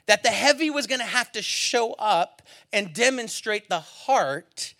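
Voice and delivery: loud, high, slow preaching build. Acted. Pastor preaching God's plan, slow and loud. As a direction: lean into each phrase, preaching.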